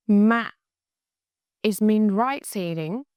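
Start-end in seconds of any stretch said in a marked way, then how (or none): 0.1-0.5 "Mạ" short and heavy, with a sudden drop; louder "Mạ" in the nặng tone; heavy dropping tone. Shown as a demonstration. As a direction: cut "Mạ" short as it falls. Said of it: "Drop quickly".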